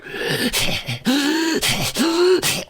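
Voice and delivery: high, drawn-out mocking giggle; high creepy giggle. The slime thing in the basket laughing at Kat, high creepy giggle. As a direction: giggle like a gleeful little monster.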